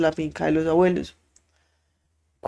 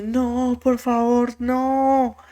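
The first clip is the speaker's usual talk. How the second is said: retold inconsolable crying; 6 semitones higher, much slower than usual. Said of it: "Rosa desconsolada llorando y llorando".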